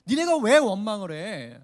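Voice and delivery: loud, slow, scolding question; prophet's rebuke. Acted. Isaiah scolds the grumbling exiles, loud and slow. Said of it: "하면서 얘기하는 거예요".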